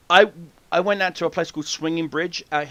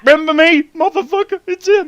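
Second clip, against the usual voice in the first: much higher, louder outraged bear; voicing the bear; 12 semitones higher, 8 dB louder than usual. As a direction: play the bear spotting its foe.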